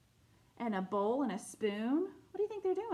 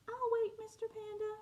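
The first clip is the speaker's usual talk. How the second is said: little penguin; 11 semitones higher, 4 dB quieter than usual. Acted. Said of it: "said the little penguin".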